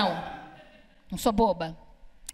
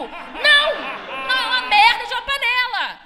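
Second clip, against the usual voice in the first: exasperated shout; 9 semitones higher than usual. Acted. Comic ranting at an audience member afraid of pressure cookers, exasperated shout. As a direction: yell it like it's obvious.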